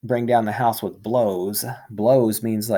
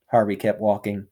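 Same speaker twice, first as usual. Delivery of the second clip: usual speaking voice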